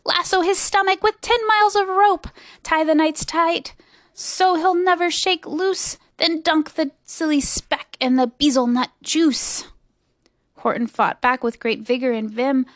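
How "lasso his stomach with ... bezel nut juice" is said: high-pitched, excited commands; rally a gang to capture him; mild shout of mob orders. A narrator's reading. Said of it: "they shouted".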